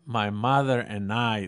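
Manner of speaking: slow, drawn-out Southern California English; Southern California accent. Retold or imitated. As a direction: give it the father-in-law's Southern California drawl. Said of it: "mi suegro con ese acento del sur de California". (con acento del sur de California, lento)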